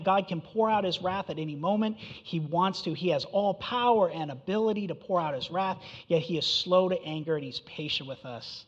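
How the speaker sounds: ordinary talk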